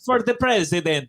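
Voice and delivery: put-on comic Italian accent; jokey Italian accent on request. Shown as a demonstration. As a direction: do a playful mock Italian accent. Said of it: "Do your Italian accent".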